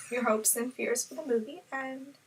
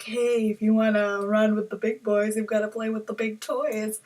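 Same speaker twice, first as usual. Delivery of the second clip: a hint of a cocky gangster voice, slightly louder; Joker's swagger; 4 dB louder than usual. Joker hands Harley a gun, lightly cocky voice. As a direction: lean into it like a smug boss. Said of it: "he's probably like".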